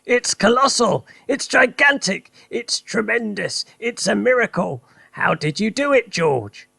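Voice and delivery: Mister Cranky shouts in wonder; excited shout. Acted. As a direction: shout it with glee. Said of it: "Mister Cranky shouted, dancing around".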